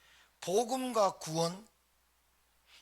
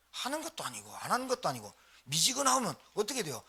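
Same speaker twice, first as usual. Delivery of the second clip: ordinary talk, nothing marked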